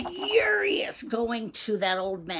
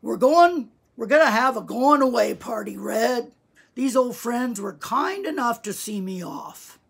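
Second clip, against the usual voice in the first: put-on loud, hearty old man's voice easing off; wheezing old man; 5 semitones higher, 10 dB louder than usual. A narrator's reading. Old man in bed welcoming the boy, loud and wheezy. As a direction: call out the party news like an old man. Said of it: "he wheezed".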